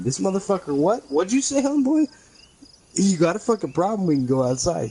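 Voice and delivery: high, rising shout-like challenge; heated retold dare. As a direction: step up and dare him to fight. Squaring up to the cook.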